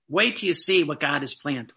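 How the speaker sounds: normal talking voice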